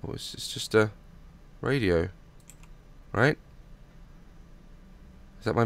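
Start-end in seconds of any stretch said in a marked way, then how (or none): none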